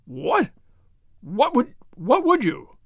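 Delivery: taken aback, groping for words; gasping. A narrator's reading. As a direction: stumble over the question in broken starts. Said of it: "His Excellency gasped".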